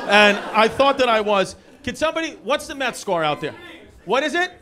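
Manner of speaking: loud, high comic indignation; mock outrage. Comic mock-annoyed at a crowd checking the Mets score. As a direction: huff at the crowd over the Mets.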